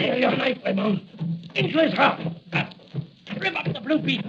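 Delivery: shrill-free villain urging a stab in the heart; frantic order. Acted. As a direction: egg him on to stab, pitched high. Villain urges stabbing.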